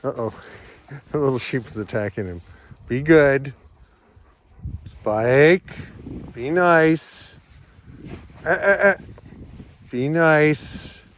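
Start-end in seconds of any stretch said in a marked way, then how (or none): 2.9-11.0 louder, sharp warnings; firm dog commands. Real, not acted. Owner warning her dog Spike off the sheep, louder. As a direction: keep the dog in check.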